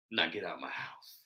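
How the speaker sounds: low, very soft, almost whispered quote; hushed retold order. Host retelling father throwing family out, hushed. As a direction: drop to a near-whisper for the quote.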